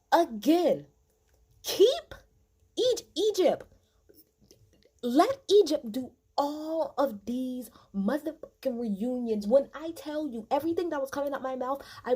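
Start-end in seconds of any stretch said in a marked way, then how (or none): 0.1-9.5 loud, hyped-up praise, much higher; excited shouting. Real, not acted. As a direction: shout it out like a proud fan.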